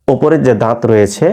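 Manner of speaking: ordinary delivery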